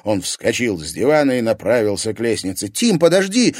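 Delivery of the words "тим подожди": high, loud cry after him; calling out. A narrator's reading. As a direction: call him back. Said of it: "крикнула ему Лиза".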